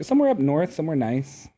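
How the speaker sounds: normal talking voice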